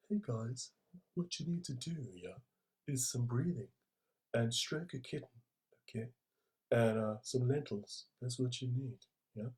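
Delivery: a hint of a soft, quiet hippie voice; lightly mock a gentle caftan-wearing guru; quietly sending up a wellness hippie. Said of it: "seven stone and had long flowing hair".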